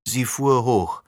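usual speaking voice

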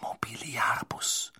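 slow unvoiced whisper; whispered spell. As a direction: breathe the spell out softly. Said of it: "Über ihm flüsterte Hermine".

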